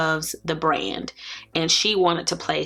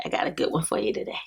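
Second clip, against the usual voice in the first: fast, breathy half-whispered tease; a quick secret aside to viewers; 2 semitones lower, 5 dB quieter, much faster than usual. Real, not acted. Teasing a big haul.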